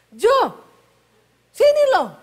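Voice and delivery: loud, high-pitched call across the house; high Sulawesi call. Retold or imitated. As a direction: shout for him in a sharp high tone. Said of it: "Orang Sulawesi kalau ngomong nadanya tinggi terus".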